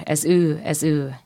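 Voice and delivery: normal talking voice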